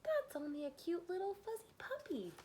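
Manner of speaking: put-on high, soft baby talk; cooing. Burglar cooing over the dog in high baby talk. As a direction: coo at the puppy.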